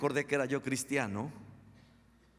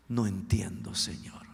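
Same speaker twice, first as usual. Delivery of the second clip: hushed, breaking into a whisper; tearful plea; 4 semitones lower than usual. Retold or imitated. Preacher retelling his prayer to God, tearful whisper. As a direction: plead tearfully under your breath. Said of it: "le dije a Dios llorando".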